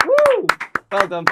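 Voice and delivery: high, loud welcoming cheer. Real, not acted. Hosts welcoming producer Ben after his engagement, excited cheer. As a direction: whoop him into the room.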